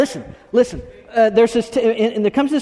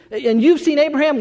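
higher-pitched mock disbelief; incredulous challenge; 6 semitones higher than usual. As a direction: ask it as an acted, disbelieving challenge.

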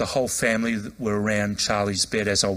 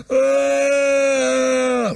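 sudden piercing wail, much higher and louder; loud anguished cry; 9 dB louder than usual. Retold or imitated. Dying man breaking down when offered prayer, piercing wail. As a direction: let out a raw scream like a child. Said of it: "Really loud".

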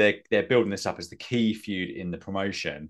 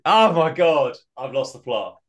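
exasperated; 5 semitones higher, 5 dB louder, slower than usual. Real, not acted. Realizes he lost the plot.

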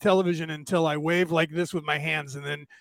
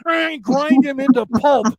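punchline burst; 6 semitones higher, 7 dB louder than usual. Acted. Punchline climax of the joke.

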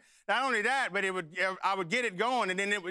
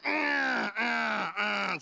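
imitated gear grinding; 3 semitones higher, much slower than usual. Son shifts to the next gear, imitated grinding noise. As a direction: mimic a gearbox grinding. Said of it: "I'm grinding gears".

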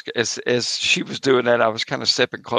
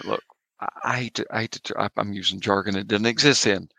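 ordinary talk

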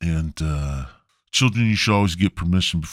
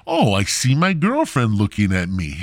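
ordinary talk

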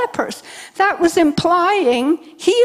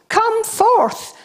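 commanding call; 7 semitones higher than usual. Retold or imitated. Jesus commanding Lazarus.

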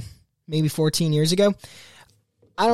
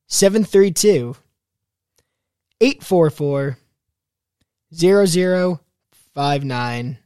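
slow digits in spaced-out groups; real slow; 5 dB louder, slower than usual. Real, not acted. Host giving listeners the call-in number, real slow. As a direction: read it out slowly, pausing between groups. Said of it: "I tried to say that real slow".

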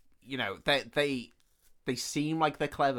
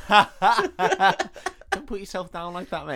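laughing through the words, louder and very slow; 6 semitones higher, 7 dB louder, much slower than usual. Real, not acted. Co-host laughs as he comforts the film's hero. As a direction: tease him while cracking up.

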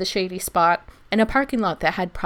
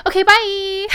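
high, bright and loud "okay, bye"; chirpy goodbye; 14 semitones higher, 9 dB louder than usual. Retold or imitated. Speaker bidding the police officers goodbye before bolting, high and chirpy. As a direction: squeak it out and bolt. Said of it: "I turn to the officers and go".

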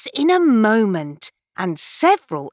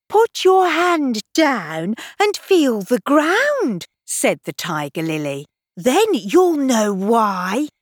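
bossy flower; 4 semitones higher than usual. Acted. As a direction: instruct like a proud flower. Tiger Lily instructs.

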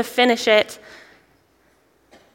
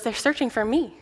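put-on soft, giggling child's answer; little giggle; 8 dB quieter than usual. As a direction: giggle the words quietly like a mischievous kid. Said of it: "he hears this little giggle".

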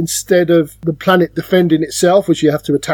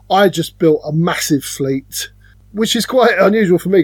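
ordinary delivery

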